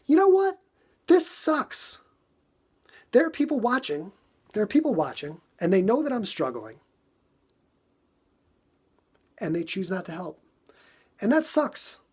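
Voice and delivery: blowing up about the watchers who won't help; frustrated outburst. Retold or imitated. Venting to himself about onlookers not helping, high exasperated outburst. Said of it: "And I'm like".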